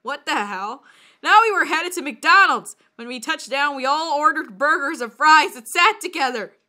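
ordinary talk, nothing marked

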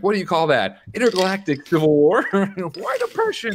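high, incredulous mock challenge; jeering retold heckle; mock heckling. Acts out a heckler's mocking questions.

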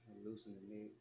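soft meditation voice, long pauses between phrases; guided meditation. Acted. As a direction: guide them gently into relaxation, leaving space to breathe.

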